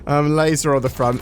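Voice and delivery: bubbling over with glee; excited. Real, not acted. Delighted about the laser.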